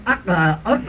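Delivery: short, grunted crow calls; fish crow call. Shown as a demonstration. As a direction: imitate a fish crow in three brief croaks. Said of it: "a fish crow goes".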